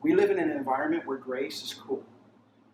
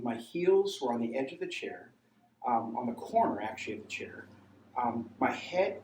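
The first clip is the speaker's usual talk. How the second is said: ordinary talk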